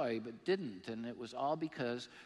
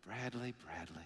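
disappointed mom; 4 semitones lower, 7 dB quieter than usual. Retold or imitated. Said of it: "a tone of disappointment in it".

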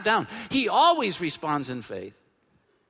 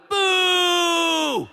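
loud, high mock booing; crowd boo; 10 semitones higher, 7 dB louder than usual. Pastor imitating the disappointed crowd booing Jesus loudly. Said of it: "the whole crowd went".

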